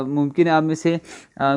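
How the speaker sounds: ordinary delivery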